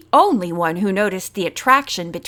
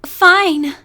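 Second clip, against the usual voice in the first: faltering; 6 semitones higher, 5 dB louder than usual. A narrator's reading. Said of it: "she faltered".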